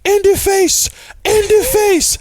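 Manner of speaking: high, slow gloating cheer; high triumphant taunt quoting Coming to America; movie celebration. Retold or imitated. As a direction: crow it out like a fan at the game. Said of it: "and he's like".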